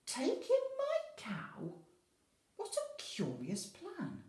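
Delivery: put-on very high, slow surprised old lady; a squeaky puzzled granny. Old lady puzzled by the man's advice, high and slow. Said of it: "old lady answers the man".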